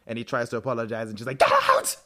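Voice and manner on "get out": sudden very high, loud yell; acted furious shout. Bonnie cutting off Damon's apology, furious shout. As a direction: explode on it, full-voiced and high.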